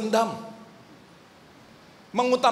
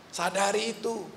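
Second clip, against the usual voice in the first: ordinary talk, nothing marked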